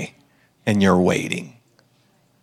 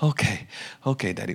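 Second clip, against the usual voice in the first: put-on calmed child; 3 semitones lower, 9 dB quieter than usual. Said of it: "She, she calmed down".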